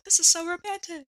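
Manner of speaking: high dreamy mock-romantic line; mock swooning alien. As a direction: swoon like a lovestruck monster. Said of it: "I'm sure the alien is like".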